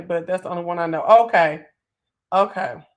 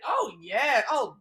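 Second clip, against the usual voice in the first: mock shocked gossip reaction; 5 semitones higher, 6 dB louder, much slower than usual. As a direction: play the eager gossip, each oh more scandalized. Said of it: "I'll be up here like".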